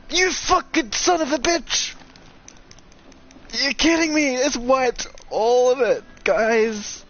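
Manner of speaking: high, loud disbelieving yell; real shocked outburst. Real, not acted. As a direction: cry out in outrage at losing it all. Discovers the game progress is wiped.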